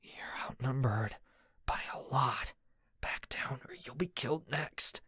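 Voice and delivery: urgent whisper in his ear. A narrator's reading. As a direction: hush him, warn him in a whisper. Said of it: "whispered in my ear".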